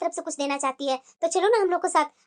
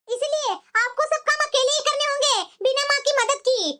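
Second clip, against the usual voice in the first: haughty sister-in-law lecturing her, pitched up; bossy sister-in-law; 9 semitones higher than usual. Acted.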